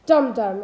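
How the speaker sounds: Dum-dum, both beats loud and heavy; two equal stresses. Shown as a demonstration. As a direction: hit both syllables of Dum-dum hard. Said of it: "two stressed syllables in a row".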